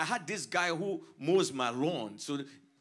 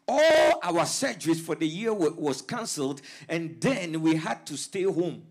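loud, driving preacher cadence; preaching at full force; 3 semitones higher, 8 dB louder than usual. Real, not acted. Pastor testifying about covid year, loud full-force preaching. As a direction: preach it hard to the congregation.

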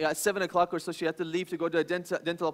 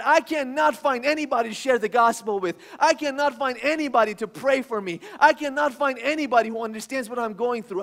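complaining loudly, higher and louder; shouting to God; 5 semitones higher, 6 dB louder than usual. Acted. Believers shouting complaints to God, higher and louder. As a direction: cry out in frustration to heaven. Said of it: "we are shouting to God".

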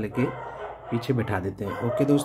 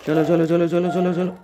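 higher, louder cheering on; excited urging; 5 semitones higher, 4 dB louder than usual. Pretend. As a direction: cheer the bike on, pitched up and loud. (उत्साह से ऊँची और तेज़ आवाज़ में चलो चलो)

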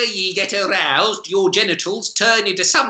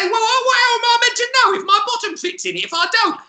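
high, fast, offended squeal; high indignant customer; faster than usual. Retold or imitated. Customer defends her odd test to staff, high and huffy.

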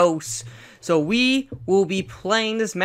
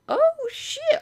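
startled squeak. Real, not acted. Surprised reaction.

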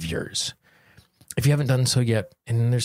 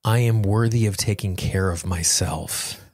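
guided tapping affirmations. Acted. Guiding listeners through a tapping session, slow and calm. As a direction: lead the listener gently through each phrase.

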